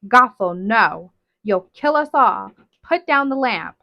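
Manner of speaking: loud pleading shout to drop the lamp; panicked shout. Acted. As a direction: bark it at her in alarm.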